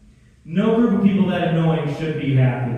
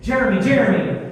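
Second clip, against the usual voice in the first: anxious mom imitation; 4 semitones higher, 7 dB louder than usual. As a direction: play an overwrought mom catastrophizing, louder toward the end. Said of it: "a lot of my anxiety from my mom".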